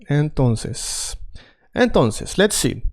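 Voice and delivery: ordinary talk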